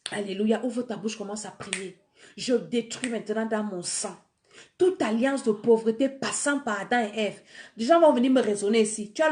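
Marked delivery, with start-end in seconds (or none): none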